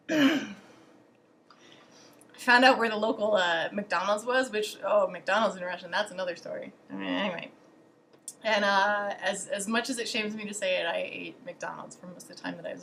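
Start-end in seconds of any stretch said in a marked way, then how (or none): none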